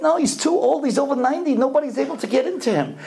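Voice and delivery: high-pitched, insistent objection; retold protest. Mother telling the stranger the rabbi is too old, protesting. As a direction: act out a distressed objection.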